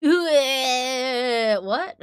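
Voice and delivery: usual speaking voice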